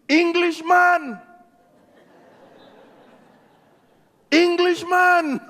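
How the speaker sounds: slow, high calling out; kids shouting. Retold or imitated. Antiguan kids calling out to him, high and slow. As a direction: call across the street like a child. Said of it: "The kids are shouting".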